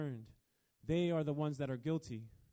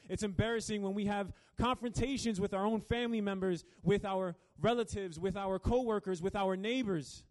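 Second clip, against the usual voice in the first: higher, louder preaching, pressing forward; preaching at full force; 6 semitones higher, 6 dB louder than usual. Acted. Preacher driving home the point to the congregation, fervent. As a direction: build the point with rising fervor.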